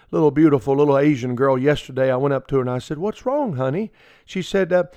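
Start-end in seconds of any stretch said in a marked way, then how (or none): 3.0-3.9 tender concern. Retold or imitated. Comforting crying little girl.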